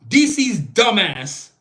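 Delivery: dumbass said slowly, every syllable drawn out; stress on dumbass. Real, not acted. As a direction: lean hard and slow on dumbass. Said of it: "I do stress the word dumbass".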